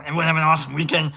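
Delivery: ordinary talk, nothing marked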